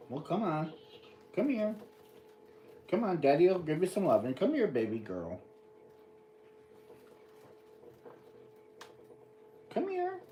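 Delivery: dad calling his little dog over, high coaxing voice; coaxing pet talk. Real, not acted.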